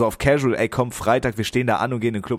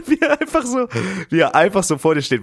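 laughing; 8 semitones higher, 3 dB louder than usual. Real, not acted. Describing the card-reader worker to his co-host, laughing. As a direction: laugh breaks out, then high amused voice.

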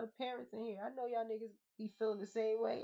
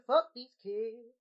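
slow, drawn-out preacher hit; mock call and response; 7 dB louder, much slower than usual. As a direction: stretch it out like a call for amen. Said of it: "Can I get a".

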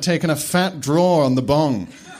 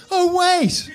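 gleeful outburst. Acted. Dumbledore bursting out laughing.

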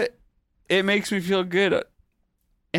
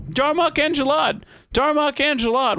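high, declaiming alien phrase; alien proclamation; 10 semitones higher than usual. Retold or imitated. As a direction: proclaim it like a ritual. Quoting alien catchphrase.

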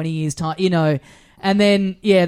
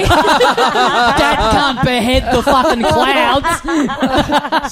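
loud, high punchline through laughter; laughing outburst; 7 semitones higher, 5 dB louder, much slower than usual. Real, not acted. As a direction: crack up while shouting the joke, drawn out. Shouting a joke while laughing.

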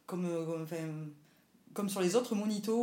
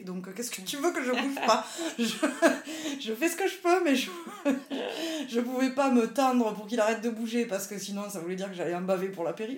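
high, loud, laughing exasperation; 5 semitones higher, 8 dB louder than usual. Retold or imitated. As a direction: laugh off the impossible order. Retorting to the anesthetist.

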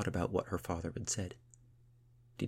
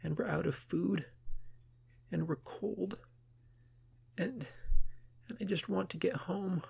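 much higher, tearful crying voice; lost girl crying in the boat; 12 semitones higher than usual. Acted. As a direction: sob it out, pleading to go home. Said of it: "Iareth began to cry".

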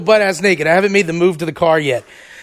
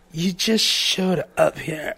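very slow, soft and dazed; imitating her drunk slowness; slow drunk drawl; 7 dB quieter, much slower than usual. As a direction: say it slowly, half asleep. Girlfriend drunk accusation.

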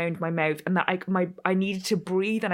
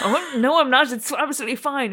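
mock cheerful denial; 6 semitones higher, 5 dB louder than usual. Retold self reassuring brightly.

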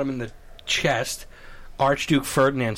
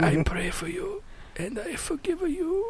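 slow, quavering mock blessing; hammed-up deathbed forgiveness, rising high at the end; frail pope; 13 semitones higher, 6 dB quieter than usual. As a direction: draw it out weakly and tenderly. Weak pope forgiving his attacker.

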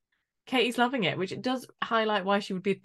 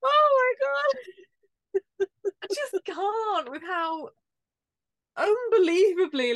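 laughing disbelief; 11 semitones higher, 3 dB louder, much slower than usual. Real, not acted. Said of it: "laughing disbelief".

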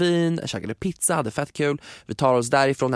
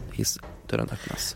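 slow, much softer, half whispered; dramatic hush; 13 semitones lower, 8 dB quieter, slower than usual. Real, not acted. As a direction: build suspense at the lift door. Dramatisk berättarpaus. (dramatiskt viskande, långsamt)

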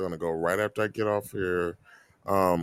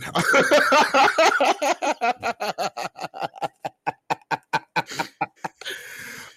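long cackle that tapers off gradually; big laugh; 13 semitones higher than usual. Real, not acted. Cracking up at own joke.

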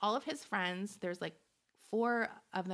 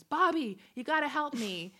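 loud, high panicked call; screaming for help; 8 semitones higher, 7 dB louder than usual. Retold or imitated. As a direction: yell it, higher and louder. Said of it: "he screams".

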